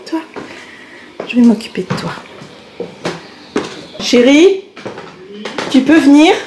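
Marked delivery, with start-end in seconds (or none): 4.0-6.3 calling out. Real, not acted. Calling out to someone.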